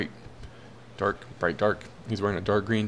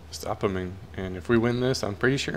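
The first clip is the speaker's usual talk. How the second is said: ordinary delivery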